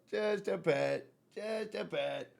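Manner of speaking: hushed, sweet pet talk; soft coo. Real, not acted. Owner giving just a pet, soft cooing. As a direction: coo softly while petting. Cooing while petting cat.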